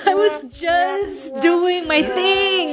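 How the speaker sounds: high, slow, drawn-out protest; playful protest. Acted. Wrestler playing innocent about on-screen tension, playful high protest. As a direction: stretch each word up high, half laughing.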